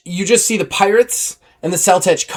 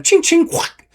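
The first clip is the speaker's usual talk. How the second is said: sword sound effects. Shown as a demonstration. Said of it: "people come at him".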